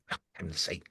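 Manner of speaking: low, very soft grumble; acted weary exasperation; exasperated aside. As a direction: grumble it under your breath. User exasperated at the assistant.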